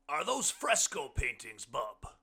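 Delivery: loud, high questioning voice; booming, inquisitive guest; hearty blind man. A narrator's reading. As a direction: ask it big and high. Blind man's question in story.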